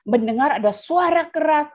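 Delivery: ordinary talk, nothing marked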